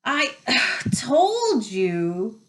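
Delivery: winded gasp with a stitch. A narrator's reading. Hermione gasps at her friends with a stitch, winded. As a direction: catch a sharp breath mid-phrase, out of breath. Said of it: "Hermione gasped, clutching at the stitch".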